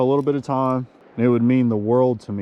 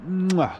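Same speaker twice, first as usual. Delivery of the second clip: higher-pitched "mwah" kiss noise; smacking kiss; 6 semitones higher than usual. Real, not acted. Angler thanking the fish with a kiss, smacked mwah. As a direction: plant a voiced smooch. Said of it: "I'm gonna kiss this fish".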